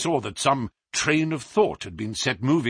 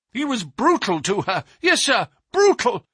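indignant; 8 semitones higher, 4 dB louder than usual. A narrator's reading. Manager denouncing his employer.

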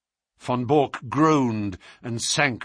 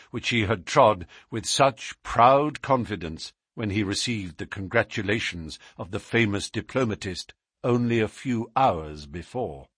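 ordinary delivery

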